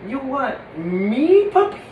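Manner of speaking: high, small character voice; puppet's surprise. Acted. Puppet and puppy meeting in store, high surprised voice. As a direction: squeak it out in rising disbelief.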